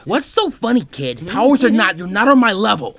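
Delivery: high, loud scornful jeer; angry taunt. Acted. Fu sneering at Naruto, loud and high. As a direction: sneer down at the kid.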